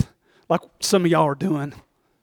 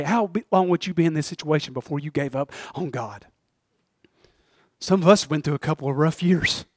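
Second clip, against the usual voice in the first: ordinary talk, nothing marked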